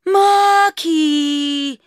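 high loud call from above; shouted name. Acted. Natasha calling down to Mark from above, loud and high. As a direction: shout it down from a balcony. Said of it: "she called again".

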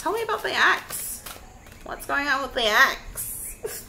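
high, teasing sing-song question, slowed; playful high voice. Real, not acted. Tarot reader teasing viewers about the ex, high playful voice. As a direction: coax the story out in a high playful tone.

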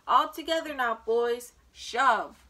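loud heave-ho command; rally the team, then punch SHOVE; rallying call. A narrator's reading. Man calls the team to push.

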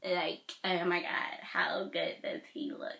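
hushed gushing whisper; breathy whisper. Real, not acted. Scene: vlogger whispers over a photo of her crush, swooning. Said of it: "why am I talking like this?".

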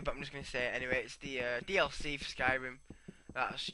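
ordinary talk, nothing marked

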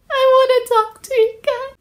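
high, sugary mock-sincere YouTuber voice; fake apology voice. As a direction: open the apology in a falsely sweet high voice. Parody fake apology video opening.

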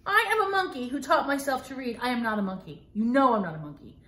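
ordinary delivery

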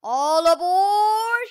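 loud slow drawn-out call; shouting. Acted. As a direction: call it like a conductor. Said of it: "he shouted".

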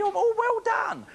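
high-pitched, over-the-top praise; hammed-up applause for an easy answer; mock congratulation. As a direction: gush mockingly, pitch soaring.

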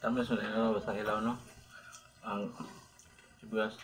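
talking while chewing food; words muffled by chewing; mouth full chewing. Real, not acted. Seen 0: Left man bites food at 0.1 and chews while talking about onion.

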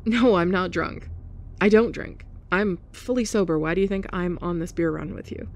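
plain reading voice

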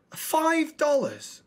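high, slow disbelieving question; shocked. Real, not acted. Gamer balking at the mask's price, stretched disbelief.

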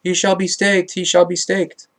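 mild narrated shout; restrained crowd shouting. A narrator's reading. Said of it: "shouting, quote".

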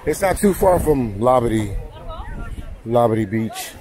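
normal talking voice

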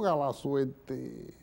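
normal talking voice

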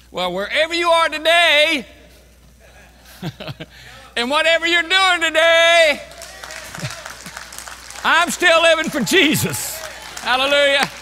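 high, loud triumphant preaching; fiery preacher's victory cry; preaching shout. Real, not acted. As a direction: shout it out to the whole church. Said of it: "preaching at full force".

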